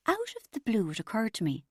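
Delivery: usual speaking voice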